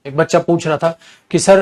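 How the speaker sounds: ordinary talk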